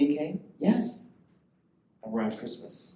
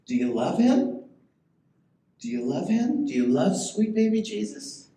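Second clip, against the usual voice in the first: asked louder and higher each time, rising fervor; building altar call; 7 semitones higher, 4 dB louder than usual. Real, not acted. Preacher presses the congregation on loving Jesus, building fervor.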